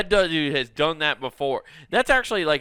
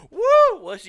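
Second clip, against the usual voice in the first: excited whoop; 9 dB louder than usual. Pretend. Surgeon whoops before cutting.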